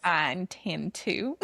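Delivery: slow, put-on Southern accent; playful imitation of a Southern accent; fake Southern accent. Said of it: "accent is so good".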